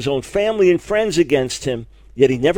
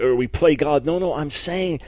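ordinary talk

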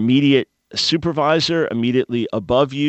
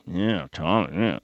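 lower, softer lazy yeah to a superior; sloppy casual reply; 6 semitones lower, 6 dB quieter, slower than usual. Retold or imitated. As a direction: mumble it like you don't care. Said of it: "No, no, no".

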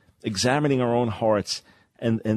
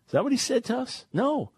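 incredulous rhetorical question; 13 semitones higher than usual. Real, not acted. Rhetorical question, pitch jumps high.

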